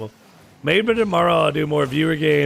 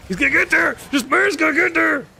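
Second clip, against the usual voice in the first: excited high voice; 12 semitones higher than usual. Real, not acted. Hyping a teammate's shot.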